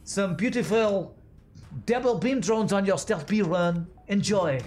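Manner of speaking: high, hammed-up French chef accent; bad French accent. Chef presenting dishes to a diner, bad French accent. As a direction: serve it up slowly in a mock French accent. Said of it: "That was my bad French accent".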